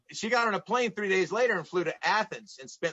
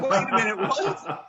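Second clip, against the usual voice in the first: wait a minute, what, said through a laugh, pitch jumping higher; laughing disbelief; 4 semitones higher, 4 dB louder than usual. Real, not acted. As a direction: laugh out a stunned double take. Hears he'll be beaten up.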